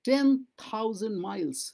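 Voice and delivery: each word drawn out slowly for learners; slow model reading. Shown as a demonstration. Teacher to Bengali students, slow careful reading. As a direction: read it slowly so students can follow. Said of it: "teacher repeats model sentence slowly".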